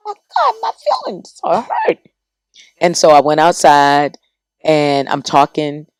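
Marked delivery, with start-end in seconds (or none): 0.1-2.0 put-on squeaky, very high wounded child; mock hurt child. Child telling grandmother her feelings are hurt, squeaky mock hurt. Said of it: "I said".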